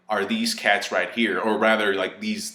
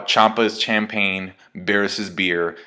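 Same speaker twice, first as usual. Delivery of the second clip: normal talking voice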